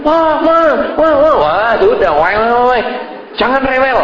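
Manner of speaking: put-on high, whiny little-kid wail; a fussy child wailing at Mum in the mosque; whining child. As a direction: wail like a small child begging for attention. Child acting up, wailing for attention.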